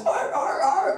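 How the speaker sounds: high seal barks in quick bursts; high-pitched mock seal voice; barking seal. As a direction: bark it out like a seal. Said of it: "the seal's like".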